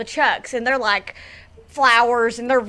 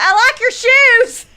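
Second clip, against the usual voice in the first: put-on much higher, louder little kid; kid's voice; 11 semitones higher, 7 dB louder than usual. Imitating the kids complimenting her shoes, higher and louder. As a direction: chirp it like an excited child. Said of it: "And they're like".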